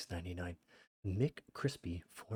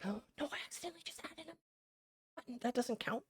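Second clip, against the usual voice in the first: gasping high panicked rush, much higher and fast; high panicked surprise; startled gasp; faster than usual. Real, not acted. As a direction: blurt it after a sudden gasp. Accidentally hit a button.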